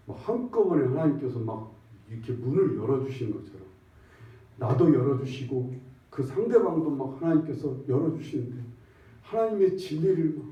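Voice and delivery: ordinary talk, nothing marked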